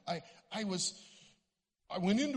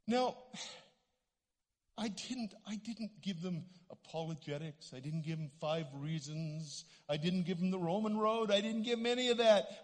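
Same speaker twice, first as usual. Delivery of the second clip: ordinary delivery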